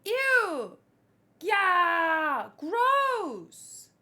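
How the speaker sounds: excited disgust. Real, not acted. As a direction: yell it, thrilled and grossed out. Reacting to giant grasshopper.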